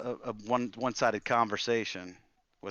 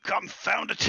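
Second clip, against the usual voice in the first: a sharp, much higher cry of annoyance; old wizard's irritated growl, pitched way up; 10 semitones higher than usual. Acted. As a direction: bark it out at the mess. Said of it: "and he growls".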